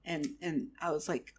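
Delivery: ordinary talk, nothing marked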